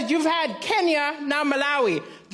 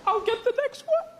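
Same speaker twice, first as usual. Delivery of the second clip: sheepish mumble; 8 semitones higher than usual. Retold or imitated. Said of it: "I had to go".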